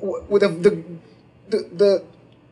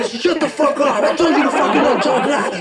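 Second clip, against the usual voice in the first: higher and fast, hammed-up shout; imitating the lawyer scolding his client yet again; mock furious yell; 7 semitones higher than usual. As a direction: rattle off the scolding at her fast. Lawyer yells at client third time.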